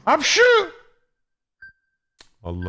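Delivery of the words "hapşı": high sneeze; sharp sneeze; sneezing. Pretend. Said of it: "Çok yaşa abi bu arada". (hapşırma)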